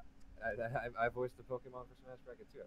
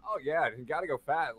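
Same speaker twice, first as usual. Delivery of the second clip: Roger impression; 6 semitones higher, 8 dB louder than usual. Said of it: "trying to be Roger as best I could".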